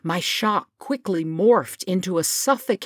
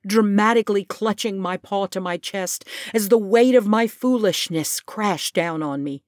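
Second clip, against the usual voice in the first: ordinary delivery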